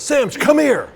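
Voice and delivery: loud, high-pitched coach's call; gruff summons. Retold or imitated. Coach summoning his quarterback from the chair, loud barked summons. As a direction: call the player over sharply and loudly. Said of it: "I can already hear it in his voice".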